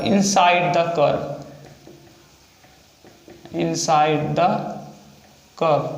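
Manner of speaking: slow, weighty "inside the curve" with pauses; stressed phrase. Shown as a demonstration. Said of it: "इतना आपको ध्यान में रखना है". ("inside the curve" धीमे, ज़ोर देकर)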